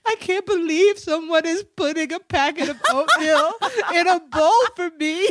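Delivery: hammed-up overwhelmed gratitude; so grateful. Patient marvelling at mom's oatmeal, exaggeratedly grateful. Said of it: "so grateful".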